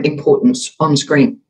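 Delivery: ordinary talk, nothing marked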